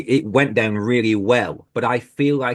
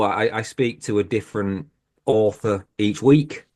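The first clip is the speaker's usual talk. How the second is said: normal talking voice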